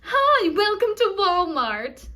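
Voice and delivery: high, loud, stretched-out greeting; over-happy store greeter. Retold or imitated. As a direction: cuckoo greeter singsong welcome. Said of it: "she goes".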